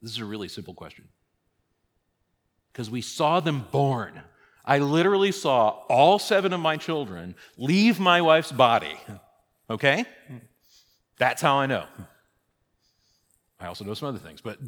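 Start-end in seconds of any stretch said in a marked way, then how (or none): 2.7-11.9 louder, higher, driving each point home; raised full-voiced sermon delivery; fervent preaching. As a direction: preach it with conviction.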